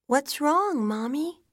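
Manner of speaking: put-on high-pitched child's question; small child. A narrator's reading. Daughter asking weeping mother what's wrong, small child's voice. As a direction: ask like a worried little girl. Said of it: "she asks".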